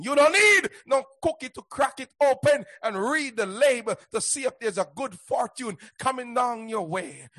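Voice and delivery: ordinary delivery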